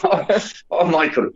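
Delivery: high, hammed-up Irish brogue; a bad put-on Irish accent. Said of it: "sorry, that's bad".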